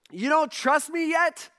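high, slow, exasperated question; incredulous challenge. Acted. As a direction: push the pitch up in disbelief. Jesus voiced, woken by disciples.

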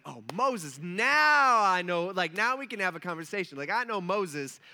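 light bulb goes on, stretch it out loud; sudden realization. Acted. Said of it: "like, light bulb goes on".

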